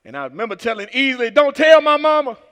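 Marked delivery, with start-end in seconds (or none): 1.3-2.4 loud tearful plea. Retold or imitated. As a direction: beg through tears. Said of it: "I was crying".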